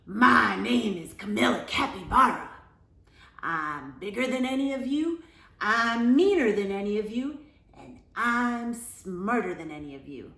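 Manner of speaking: big, loud boastful bully; booming bragging capybara; loud bragging announcement. A narrator's reading. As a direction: announce yourself like you own the room. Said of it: "barged into the classroom and announced".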